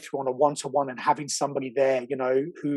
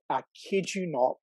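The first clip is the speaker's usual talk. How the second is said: ordinary talk, nothing marked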